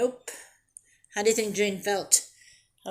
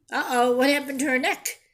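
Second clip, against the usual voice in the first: ordinary delivery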